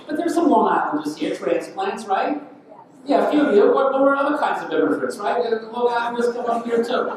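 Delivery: high, loud, teasing the audience; rally the room with a grin; playful crowd banter. Real, not acted. Teasing the crowd about transplants.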